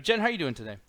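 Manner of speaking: normal talking voice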